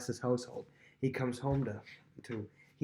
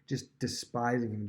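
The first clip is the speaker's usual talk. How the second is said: ordinary talk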